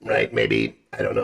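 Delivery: very low, gruff voice. Shown as a demonstration. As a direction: drop the voice very low. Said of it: "I am a robot".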